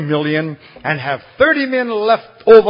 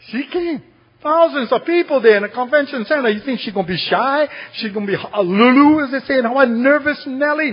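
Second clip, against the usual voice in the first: high, loud rhetorical questions; incredulous preaching; 10 semitones higher, 4 dB louder than usual. Real, not acted. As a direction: pile up disbelieving questions, voice pitched high. Preacher mocks idea of shy child.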